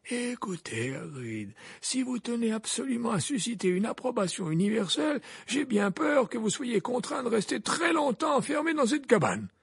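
put-on gently probing old headmaster; Dumbledore voice. A narrator's reading.

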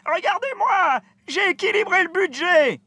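very high, triumphant cry; a proud adviser showing off; excited cry. Acted. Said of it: "s'écria le conseiller financier".

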